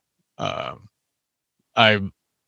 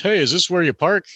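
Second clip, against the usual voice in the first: a higher call from a passing car; higher casual shout-out from a car; 8 semitones higher than usual. Retold or imitated. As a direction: sing it out higher, like a friend calling from a car. Voice calling from a car.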